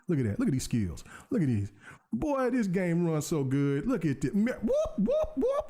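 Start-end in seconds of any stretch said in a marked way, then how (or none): 4.3-5.6 high laughing whoop, whoop. Real, not acted. Gamer cheering mid-fight, high laughing whoops. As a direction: squeal the whoops through a laugh.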